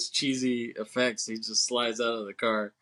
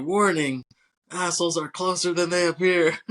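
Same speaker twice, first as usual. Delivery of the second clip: higher, louder parody of a warning; hammed-up movie quip; mock warning announcement; 6 semitones higher, 4 dB louder than usual. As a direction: proclaim it like a comic safety notice. Character's quip parodying mirror warning.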